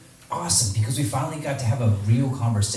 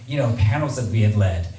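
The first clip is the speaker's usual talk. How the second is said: usual speaking voice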